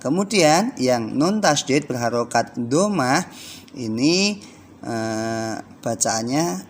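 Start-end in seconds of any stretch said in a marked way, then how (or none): none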